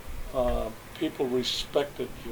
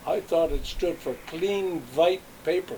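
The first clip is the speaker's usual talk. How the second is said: put-on lightly Yiddish-accented punchline; 4 semitones higher than usual. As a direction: deliver the joke like an old Yiddish merchant. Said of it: "he had sort of a Yiddish accent".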